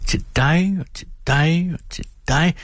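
bright, rising hand-outs; joyful giving. A narrator's reading. Mother throwing gifts to the children, joyfully rising. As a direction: toss each line up happily.

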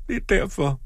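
very high, acted tearful voice; sobbing. A narrator's reading. Daughter explains her refusal to father, sobbing. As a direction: squeeze it out as if sobbing. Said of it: "hulkede hun".

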